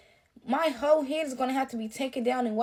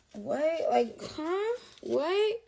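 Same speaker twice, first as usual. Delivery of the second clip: baffled questions, each climbing high at the end; high rising disbelief; incredulous; 4 semitones higher, 3 dB quieter, much slower than usual. Acted. Client venting about a botched hairdo, incredulous.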